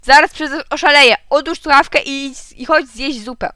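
loud, high exasperated order; dad at the end of his patience; angry shouting. A narrator's reading. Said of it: "krzyknął tata". (zirytowany krzyk taty)

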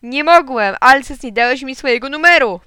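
loud, high explanation; shouting back. A narrator's reading. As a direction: explain loudly down a bad line. Shouted phone exchange continues. (głośne tłumaczenie do słuchawki)

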